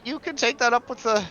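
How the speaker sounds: high, flustered clerk voice; hesitant teller, pitched high; worried bank teller. Acted. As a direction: stall the customer nervously. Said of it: "this worried bank teller says".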